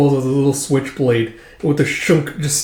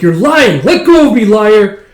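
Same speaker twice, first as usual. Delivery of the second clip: loud higher furious protest; angry; 8 semitones higher, 9 dB louder, slower than usual. Acted. Erica shouting at Mama to let go, loud and angry. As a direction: shove her away in rage. Erica pulls from mother's hug.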